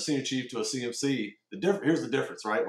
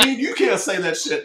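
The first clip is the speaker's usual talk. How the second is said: higher, louder comeback through a laugh; laughing mock retort; 11 semitones higher, 8 dB louder than usual. The sailor mocking his old CMC's words back at him, laughing. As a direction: toss it back while cracking up.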